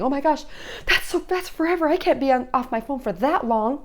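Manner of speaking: put-on high-pitched teenage protest; mock a teen who can't bear it; dismayed teen. Acts out teen's dismay at pause.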